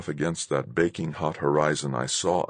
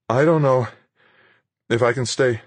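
halting, high, broken by pauses; stammering; 6 semitones higher than usual. A narrator's reading. Exhausted pilot answers Fuchs, stammering. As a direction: falter between phrases, struggling on. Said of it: "I stammered".

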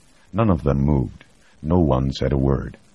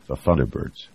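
ordinary delivery, nothing marked

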